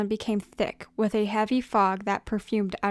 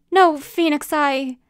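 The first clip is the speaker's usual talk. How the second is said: higher, louder, faltering apology; regretful plea; 5 semitones higher, 7 dB louder than usual. Acted. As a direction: plead in sudden regret. Regretting his hurtful words.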